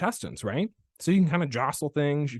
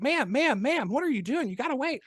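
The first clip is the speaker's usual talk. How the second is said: high, pleading "ma'am, ma'am"; urgent calling after; 10 semitones higher than usual. Retold or imitated. Chiropractor chasing a patient out of the office, urgent calling. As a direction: call after someone running off, pitched high.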